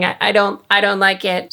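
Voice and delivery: ordinary delivery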